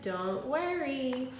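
slow, drawn-out gentle line; slow reassurance. Acted. Wimberly tells her parents not to worry, slow and gentle. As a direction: stretch it out to comfort.

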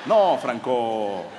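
slow, low letdown with a laugh in it; disappointed again, laughing. Retold or imitated. Friends refusing the porn theatre, slow and disappointed. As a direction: sigh it out as a let-down friend. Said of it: "fue más así como decepcionados".

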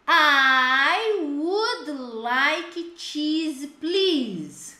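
"I would like cheese, please" drawn out slowly; slow model phrase. Shown as a demonstration. ("I would like cheese, please" bem devagar)

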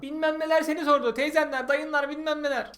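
high mocking sing-song mother. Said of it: "Arkadaşlar bakın büyük yalan".

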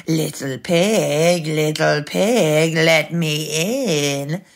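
slow, drawn-out wolf call; singsong big bad wolf. A narrator's reading. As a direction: call menacingly, stretching each word. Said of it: "he called".

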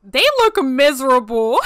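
loud exclamation breaking into laughter; loud hilarity. Real, not acted. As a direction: cry it out and crack up. Cracking up at the dinner.

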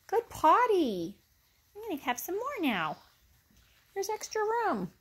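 high pet-praise voice. Real, not acted. Praising puppy, voicing it.

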